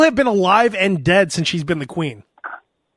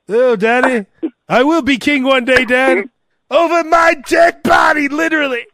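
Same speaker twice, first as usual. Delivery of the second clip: pompous royal; 5 semitones higher than usual. Pretend. Son and father voiced.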